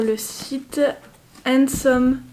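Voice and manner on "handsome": English brand name in a French accent; halting English with a French accent. Real, not acted. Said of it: "mon accent anglais est toujours incroyable".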